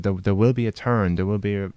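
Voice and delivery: usual speaking voice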